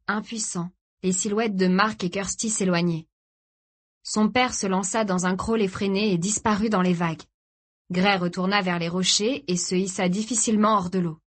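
ordinary delivery

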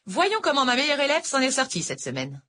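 lightly voiced older teacher; a hint of a sweet Irish tutor; sweet-talking teacher, lightly voiced; teacher Noël. A narrator's reading.

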